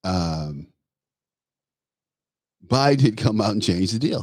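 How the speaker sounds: usual speaking voice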